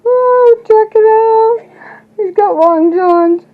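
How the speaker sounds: high, slow, delighted gush over the dog; excited squeal. Real, not acted. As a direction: coo it out like a proud dog owner. Showing off dog's long johns.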